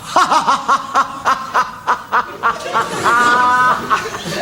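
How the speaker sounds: loud, high string of ha-has, last one held long; exaggerated laugh. Shown as a demonstration. Comic showing how not to laugh at religion, huge mock guffaw. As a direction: guffaw in separate bursts, ending on a long note. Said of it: "We don't laugh".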